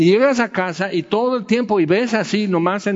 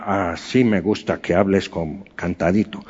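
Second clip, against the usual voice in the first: put-on old teacher; 8 semitones lower than usual. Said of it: "Si viviera, diría".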